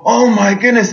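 high-pitched, aghast exclamation; retold shock. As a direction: act out horrified realization. Retold shock at near miss.

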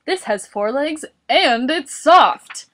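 slow, loud, insistent yell; Morris. A narrator's reading. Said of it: "Morris yelled".

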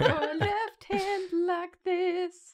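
slow high silly voice. Pretend. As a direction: stretch it out, cartoonish. Silly voice joke.

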